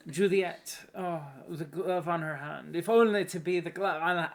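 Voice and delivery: a hint of Scottish Romeo swooning over Juliet; mock Scottish lover sighing over Juliet's glove; Scottish accent. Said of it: "Now we're going Scottish?".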